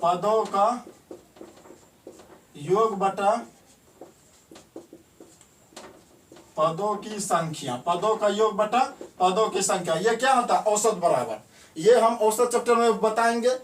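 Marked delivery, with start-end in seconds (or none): 0.0-7.8 slow, word by word with long pauses, for writing down; slow dictation. Shown as a demonstration. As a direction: dictate it slowly so students can copy. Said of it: "इसको लिखेंगे direct". (धीरे-धीरे लिखवाते हुए)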